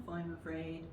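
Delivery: usual speaking voice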